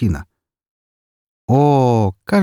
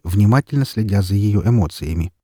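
ordinary delivery, nothing marked

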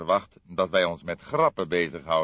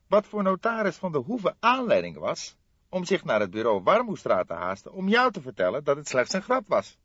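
lightly voiced aggrieved young man, higher; a young colleague, a touch higher and put out; young detective voice; 10 semitones higher, 7 dB louder than usual. Fledder protesting to his older colleague, lightly voiced aggrieved.

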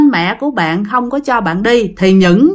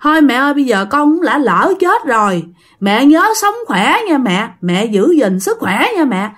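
the dead son, through the possessed woman, reproaching his mother about his father; reproachful ghost son; 5 semitones higher than usual. Acted. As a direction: whine a blame at the father, pitched high. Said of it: "Đằng này là trách móc".

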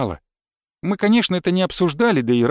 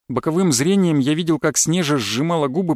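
ordinary delivery, nothing marked

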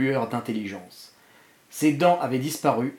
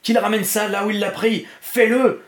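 raised voice climbing to a loud command; panicked order; 7 semitones higher, 4 dB louder than usual. A narrator's reading. Dark man orders Lloyd to remove the bomb, panicked urgency. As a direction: insist urgently, louder on the last words.

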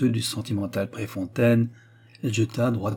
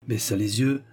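plain reading voice

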